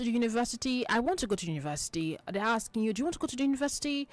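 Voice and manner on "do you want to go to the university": quick parent question. Retold or imitated. Parent's question, high and fast.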